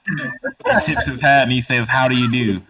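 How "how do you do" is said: southern drawl. Acted. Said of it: "Should I just put on my southern drawl".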